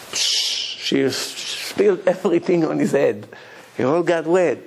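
usual speaking voice

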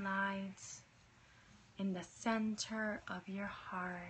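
guided meditation. Acted. Leads a meditation.

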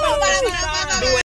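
high, loud excited yelling; giddy shout; laughing shout. Real, not acted. Shouting to stop the moment.

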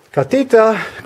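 high drawn-out call for the cat. Real, not acted. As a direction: call the cat from another room. Calling the cat again.

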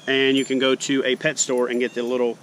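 ordinary talk, nothing marked

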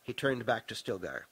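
usual speaking voice

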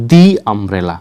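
দি umbrella, দি a long "dee". Shown as a demonstration. Teacher answering how to pronounce it, দি as "dee". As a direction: stretch দি into "dee" before umbrella. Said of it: "কিভাবে উচ্চারণ করবেন?".

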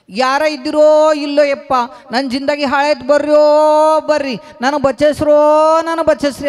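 high, loud wailing cry; crying for help. Acted. Kabir outside the gate, crying loudly for help. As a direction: howl for someone to save him. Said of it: "ಅಂತ ಅಳಕ್ ಶುರು ಮಾಡ್ದನು".

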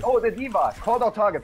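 high loud urgent orders; combat callout. Real, not acted. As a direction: yell instructions at the team. Shouting orders mid-fight.

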